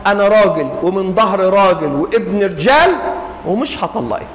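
low, calm husband; calm firm. Acted. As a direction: answer her calmly, steady.

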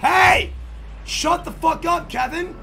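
loud, high, drawn-out scolding shout; angry dad yell. Pretend. Streamer as dad silencing Kevin, angry shout. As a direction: yell sharply to silence your son.

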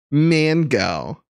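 "Mango" with a flat "man" vowel; drawn-out "MAAN-go"; exaggerated American pronunciation. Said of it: "Now he's saying mango".

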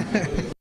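brief amused laugh; a quick chuckle; short laugh. Real, not acted. Laughs after his joke.

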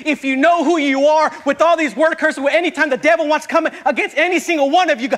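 loud, high, fervent preacher's cry; full-force preaching. Acted. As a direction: preach it out to the whole church.

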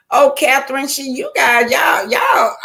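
high excited call to the viewers. Real, not acted. Proud of her viewers, calling out high and excited. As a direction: squeal it out with pride.

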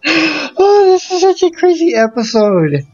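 high, excited exclamation; giddy relief. Real, not acted. Player celebrating the gym win, high and giddy. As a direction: squeal it out in relief.

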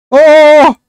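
startled cry of hermano; shocked shout. Real, not acted. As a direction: blurt it out in shock. Sudden shock at the end.

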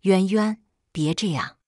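ordinary delivery, nothing marked

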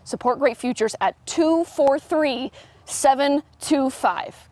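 slow, measured dictation with spaced digits; slow repeat. Real, not acted. Reporter repeats the number for viewers, slow and careful. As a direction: say each number separately.

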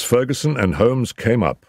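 usual speaking voice

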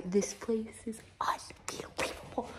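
slow awed whisper. Real, not acted. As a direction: hush it in wonder. Awestruck in warehouse.

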